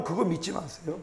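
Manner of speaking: ordinary talk, nothing marked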